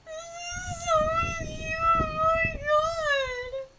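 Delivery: very high, loud, drawn-out squeal; gushing squeal. Real, not acted. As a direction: squeal every word slowly in delight. Squeals over cute manga scene.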